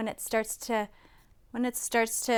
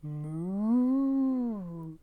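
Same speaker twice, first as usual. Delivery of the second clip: long drawn-out moo; cow's moo. A narrator's reading. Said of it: "Cow said".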